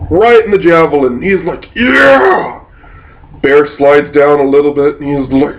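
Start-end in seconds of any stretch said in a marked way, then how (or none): 1.8-2.6 high excited whoop; a player's victory cry; triumphant yeah. Retold or imitated. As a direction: cheer like you landed the hit. Player cheering his hit.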